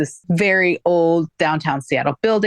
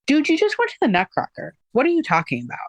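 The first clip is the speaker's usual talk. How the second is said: incredulous; 9 semitones higher, faster than usual. Retold or imitated. Incredulous reply to hypocrite.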